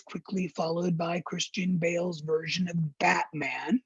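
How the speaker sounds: ordinary delivery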